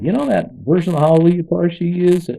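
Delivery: high, loud pointed question; old man's question. Retold or imitated. Elderly congregant questioning the pastor's song choice, high loud. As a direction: press him on that song choice.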